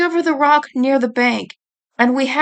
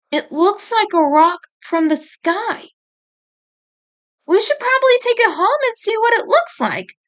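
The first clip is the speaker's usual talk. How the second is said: higher and quicker, excited little sister; eager suggestion; 9 semitones higher than usual. A narrator's reading. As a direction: rush the idea out brightly. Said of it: "Monaco suggested".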